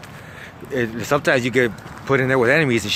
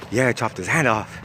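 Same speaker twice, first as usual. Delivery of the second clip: deadpan confirmation, not raised at all; straight-faced. Retold or imitated. Cellmate confirms chopping a hand, straight-faced. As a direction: imitate a matter-of-fact boast. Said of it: "with a straight face".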